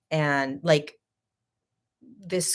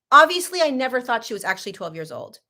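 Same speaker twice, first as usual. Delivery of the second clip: high, loud burst on Obviously; exasperated comeback, then fast; indignant retort; 5 semitones higher, 4 dB louder, faster than usual. Retold or imitated. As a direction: snap back, offended. Retold heated argument.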